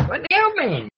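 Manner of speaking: put-on high-pitched valley-girl whine of a question; mock valley girl. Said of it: "I sound like a valley girl".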